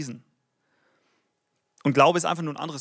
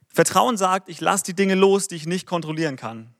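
usual speaking voice

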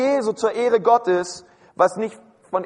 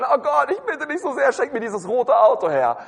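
higher-pitched, hammed-up begging to God; mock pleading prayer; 4 semitones higher than usual. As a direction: plead like an over-eager petitioner, playfully. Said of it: "ja, wir beten". (gespielt flehendes Gebet)

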